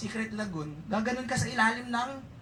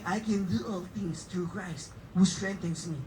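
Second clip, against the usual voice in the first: verse reading; 4 semitones lower, slower than usual. Acted. Quoting verse in slow reading voice.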